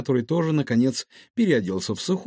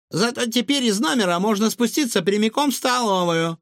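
put-on high, lively child's voice; cheeky girl Liza; 10 semitones higher than usual. A narrator's reading. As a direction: play an eager little girl, high-pitched.

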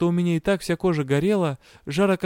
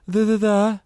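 teeth chattering; 7 semitones higher, 3 dB louder than usual. Acted. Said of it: "Зубы стучали от холода".

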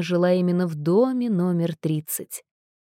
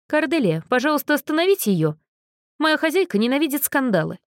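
higher, hurried worried plea; alarmed plea; 7 semitones higher than usual. Acted. Anxious Anna begs Cordelia to stop the girl, hurried. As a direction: urge her quickly, worried.

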